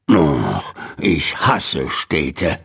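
low, slow, grumbling growl under his breath. A narrator's reading. Old man growling at city traffic, low and slow. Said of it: "knurrte Mister Morrison vor sich hin".

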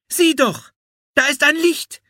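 put-on high-pitched girl's cry; excited calling out. A narrator's reading. Anne alerts Philipp to an approaching light, high excited call. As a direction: call out a sudden discovery. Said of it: "rief Anne".

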